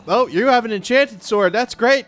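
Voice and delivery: high, nervous polite babble to a mob; nervous placating. Pretend. Player sweet-talking an armed mob, high and nervous. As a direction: back away sweetly, don't provoke him.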